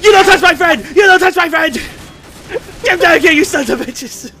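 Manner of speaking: furious high shout. Real, not acted. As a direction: scream it at the attackers. Defending friend in game shootout.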